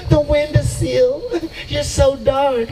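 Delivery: slow, high Southern drawl; Southern belle. Retold or imitated. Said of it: "when I had a Southern Belle".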